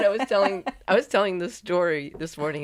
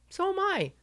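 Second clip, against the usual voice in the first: high, soft voice for her own line; high-pitched retold reply; 4 dB quieter than usual. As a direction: quote yourself in a high squeak. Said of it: "listen to the way I talk".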